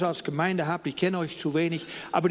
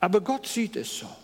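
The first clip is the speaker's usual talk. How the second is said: ordinary delivery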